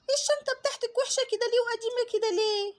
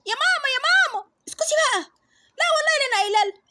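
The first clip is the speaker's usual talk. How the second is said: put-on kids clamouring; 3 semitones higher, 6 dB louder, faster than usual. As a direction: call out to mum all at once.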